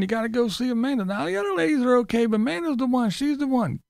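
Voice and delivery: high mocking imitation; sneering impression of a chatty vlogger; mocking Tim. Mocking Tim about boots.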